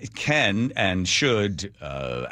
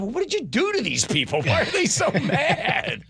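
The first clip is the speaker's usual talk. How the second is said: laughing question. Retold or imitated.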